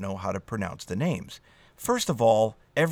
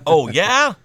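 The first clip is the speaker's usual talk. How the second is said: loud, high, rising comeback; mock challenge; 7 semitones higher, 10 dB louder than usual. Joking retort to someone correcting your pronunciation, mock challenge. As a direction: throw it back as a playful challenge.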